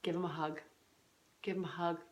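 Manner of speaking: gentle, hushed instruction; quiet tender tip; soft advice. Shown as a demonstration. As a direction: say it softly like good advice. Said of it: "the strategy I give you is".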